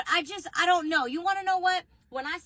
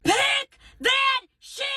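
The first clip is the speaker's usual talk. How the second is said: high, drawn-out full-force yell; furious parent scream; 13 semitones higher, 8 dB louder, slower than usual. Acted. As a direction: scream each word at the kid. Parent screaming at child, preview clip.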